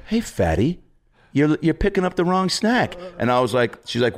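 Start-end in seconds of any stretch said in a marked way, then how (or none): 0.1-2.9 mock-sweet, much higher voice; snide mom paraphrase. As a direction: needle the fat kid in a fake-nice voice. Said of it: "basically being like".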